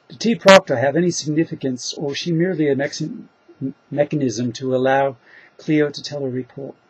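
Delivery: put-on a deliberately terrible Australian accent that drifts toward New Zealand; bad Australian accent. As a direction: read the question in a hammy, lousy Australian accent for a laugh. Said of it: "let's try it in a really bad Australian accent just for fun".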